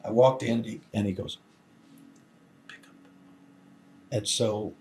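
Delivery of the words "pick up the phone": slow, hushed whisper, much softer. Retold or imitated. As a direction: whisper it so the caller can't hear. Secret instruction during phone call.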